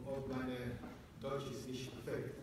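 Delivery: ordinary delivery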